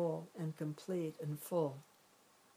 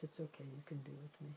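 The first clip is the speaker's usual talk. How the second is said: much softer, a gentle soothing murmur to your own upset mind; tender reassurance; 10 dB quieter, faster than usual. Shown as a demonstration. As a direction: soothe it like a frightened child. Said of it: "we welcome whatever the state of mind is".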